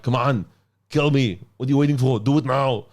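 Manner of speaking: mock action hero goading; Arnie. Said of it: "the Arnie bit".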